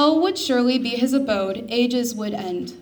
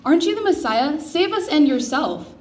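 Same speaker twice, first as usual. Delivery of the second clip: a loud, higher, mocking jeer at Jesus; insulting; 5 semitones higher, 5 dB louder than usual. A narrator's reading. Criminal on the cross taunting Jesus, loud jeering. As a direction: throw the words at him like an insult. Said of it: "threw insults at him".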